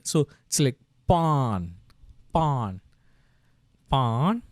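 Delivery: "pawn" drawn out slowly, said three times; syllable read slowly. Shown as a demonstration. Teacher sounding out "pawn" for learners, slowly. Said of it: "So up, a, in".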